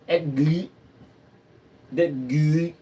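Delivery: silly voice. Pretend. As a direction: say it in an odd, silly voice. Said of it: "I don't know why I'm talking like that".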